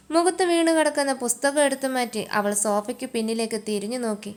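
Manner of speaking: ordinary delivery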